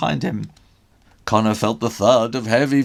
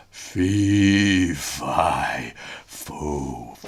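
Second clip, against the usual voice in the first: gloating ogre hush; 12 semitones lower, much slower than usual. Acted. Ogre gloating, sinking to whisper.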